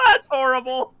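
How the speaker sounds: high-pitched cry through laughter; laughing dismay. Real, not acted. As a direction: laugh it out, appalled at the roll. Reacts to a terrible roll, laughing.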